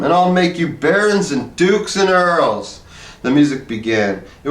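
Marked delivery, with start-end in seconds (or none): 0.0-2.8 higher, slow grand promise; regal promise. A narrator's reading. Harrison promises the musicians titles, slow and grand. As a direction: bestow titles like a king. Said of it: "he told them".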